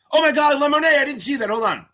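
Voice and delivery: high, loud burst of astonishment; excited surprise. Real, not acted. Streamer discovers Lemonade passed and missed it, excited surprise. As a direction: blurt it as the good news lands. Just noticed another student passed.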